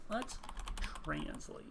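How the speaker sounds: drawn-out whisper; whispered to self. Real, not acted. Player to self while typing a search, whispered. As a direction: murmur it voicelessly while busy.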